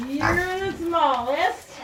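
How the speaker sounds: high, soft, slow coo. Real, not acted. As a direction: coo gently at the tiny piglet. Cooing at tiny piglet.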